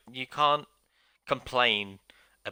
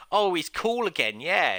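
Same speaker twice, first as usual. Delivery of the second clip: higher, slowed mock gush of a fickle fan; 7 semitones higher, slower than usual. Ranting gamer mocks fickle wrestling fans, slow and higher. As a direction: parody a fan who suddenly loves him again. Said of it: "everyone's like".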